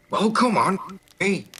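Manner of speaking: impatient wizard, high and loud; Vador. Acted. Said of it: "He looks up at you".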